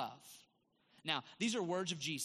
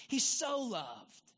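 "so" drawn out long and raised in pitch; stress on SO; 5 semitones higher, 4 dB louder, much slower than usual. Real, not acted. Preacher contrasting with just love, long emphatic "so". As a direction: lean hard and slow on "so".

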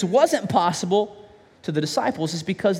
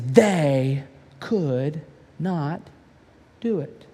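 very slow, low, dragging out the words; crushing repeat; 5 semitones lower, much slower than usual. Acted. Father pointing at the nine disciples, slow and heavy. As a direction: lean hard on each word, slowly.

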